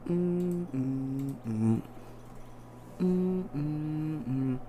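soft held hums; idle humming. Real, not acted. Streamer humming to himself while searching, soft idle humming. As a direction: hum quietly in short held notes.

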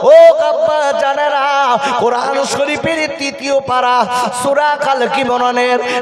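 chanted sermon storytelling; sing-song preacher's waz, full voice; rhythmic, stretched-out sermon chant; preaching. Acted.

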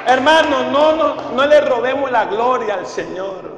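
normal talking voice

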